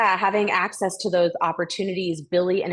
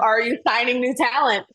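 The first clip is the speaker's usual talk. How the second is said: eager; 6 semitones higher than usual. Retold or imitated. Retelling eager audition pitch.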